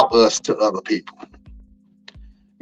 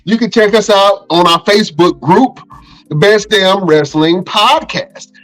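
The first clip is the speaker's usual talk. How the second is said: higher, hyped host sign-off plugging every platform; podcast outro promo; 11 semitones higher than usual. Acted. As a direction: sell the show like a pumped-up announcer.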